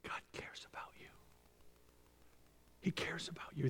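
soft unvoiced whisper; tender whisper. Real, not acted. Preacher whispering to the congregation, tender. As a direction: breathe it softly to each listener.

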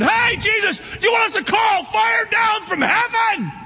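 high, loud, eager shout; eager disciples. Retold or imitated. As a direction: call out like overeager disciples. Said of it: "as they were out there going".

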